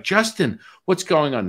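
high, loud excited greeting; mock starstruck greeting. Hypnotised man greets who he thinks is [name], mock excited. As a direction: imitate a fan hailing a star.

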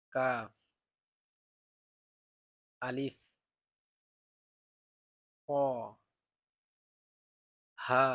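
each Arabic letter called out slowly, one by one; loud Arabic letter drill. Shown as a demonstration. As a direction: recite the alphabet clearly for learners to repeat. (আরবি বর্ণ জোরে জোরে উচ্চারণ)

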